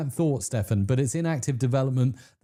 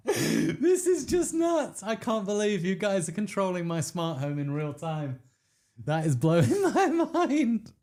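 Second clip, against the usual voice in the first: amazed squeal; 6 semitones higher than usual. Real, not acted. Streamer marvels as viewers run his lights.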